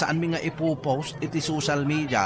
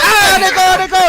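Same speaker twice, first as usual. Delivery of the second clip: high, loud yelps of pain; crying out in pain; 12 dB louder, faster than usual. Acted. Man being beaten in the fight, loud high pained yelps. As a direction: yelp like you're being hit.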